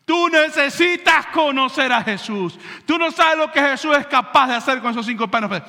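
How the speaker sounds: fervent urging. Retold or imitated. Andrés urging the boy to meet Jesus, loudly. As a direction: press it on him with fire.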